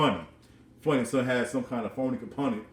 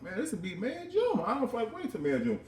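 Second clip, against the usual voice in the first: mock whiny complaint; 11 semitones higher, 3 dB quieter than usual. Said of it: "I'm like".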